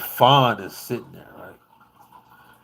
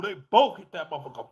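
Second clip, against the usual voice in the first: ordinary talk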